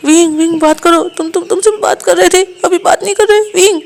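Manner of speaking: high, slow, urgent begging; desperate plea. Acted. Wang ji desperately begs the dazed Wing to talk. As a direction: shake him awake with your voice.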